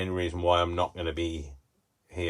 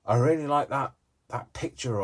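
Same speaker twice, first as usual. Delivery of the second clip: delighted, higher and louder; excited find; 6 semitones higher, 6 dB louder than usual. Pretend. Crafter spotting a magazine picture she loves, delighted. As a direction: gasp with delight at a picture you love. Imagined viewer finding a picture.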